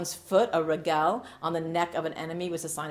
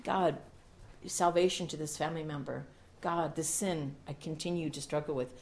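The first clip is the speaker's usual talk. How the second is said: spoken prayer; 5 dB quieter than usual. Acted. Pastor praying aloud before the church, hushed and earnest. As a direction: pray it quietly.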